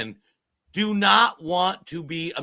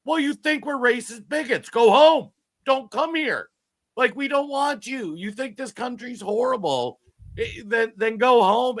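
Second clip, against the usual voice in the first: heated outburst; 7 semitones higher than usual. Real, not acted. Heated rant at critics.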